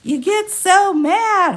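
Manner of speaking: high, slow sing-song teasing; mock teasing. Viewer teasing her about her temper, high mocking sing-song. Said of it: "I was just like".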